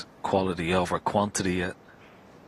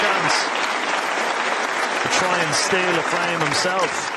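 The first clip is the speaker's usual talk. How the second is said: excited; 7 semitones higher, 5 dB louder than usual. Real, not acted. Commentator reacts to big pot.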